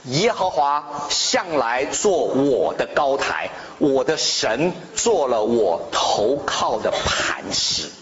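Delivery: slow, solemn reading of scripture. Acted. Pastor reading the psalm to the congregation, slow and solemn. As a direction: read the psalm aloud slowly and solemnly.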